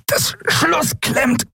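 high, slow, pressed creature voice; strained voice. Acted. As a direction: squeeze it out under effort. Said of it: "antwortete Quailor mit gepresster Stimme". (gepresste Stimme)

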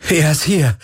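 high, elated exclamation; jubilant. A narrator's reading. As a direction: cheer the good news. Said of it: "jubelte Galen".

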